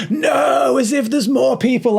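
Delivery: very high, loud incredulous shout; scoffing wife. Retold or imitated. Husband imitating his wife's disbelief at the comments, scoffing shout.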